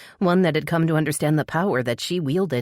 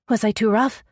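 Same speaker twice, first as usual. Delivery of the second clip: panicked; 7 semitones higher than usual. A narrator's reading. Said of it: "There was a panicked edge to her voice".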